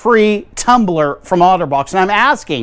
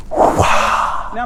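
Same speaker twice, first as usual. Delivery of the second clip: ordinary talk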